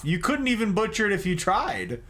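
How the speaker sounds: usual speaking voice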